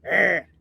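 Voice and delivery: short mock growl of anger; angry growl. Talking about the president showing anger, a mock angry growl. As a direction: growl it like a cartoon angry man. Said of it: "as in".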